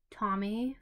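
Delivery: ordinary delivery, nothing marked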